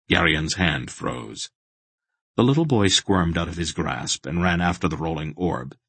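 ordinary delivery

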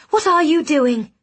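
startled question. Acted. She fled the bed, startled.

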